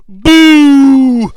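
loud, high, long boo; drawn-out jeer. Acted. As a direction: jeer like a disappointed crowd. Mock crowd jeer at the ending.

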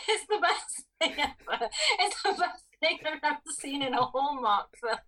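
words breaking up with laughter; laughing while talking. Real, not acted. Podcaster cracking up over a dancer in a film, laughing. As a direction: struggle to speak through real giggles.

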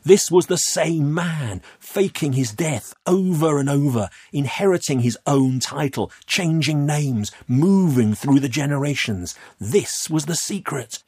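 building out a shocking discovery; urgent revelation. A narrator's reading. Narrator piecing together the Baron's faked deaths, urgent. Boy narrator's agitated realisation.

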